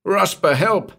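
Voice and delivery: high-pitched desperate cry; yelling for help. A narrator's reading. Trapped man calling the manager as murder threatens, yelling. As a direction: yell up the tube for rescue. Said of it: "he yelled up the tube".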